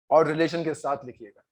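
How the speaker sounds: usual speaking voice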